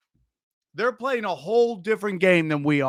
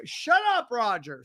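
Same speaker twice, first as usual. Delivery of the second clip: ordinary delivery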